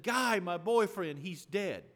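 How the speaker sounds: loud, high, slow anguished words; retold distraught cry. Daughter explaining to dad who died, loud and slow. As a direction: drag it out through grief. Daughter voiced screaming on phone.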